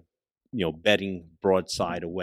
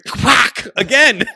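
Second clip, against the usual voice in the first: quack; 12 dB louder, slower than usual. Retold or imitated. Imitated noise while laughing.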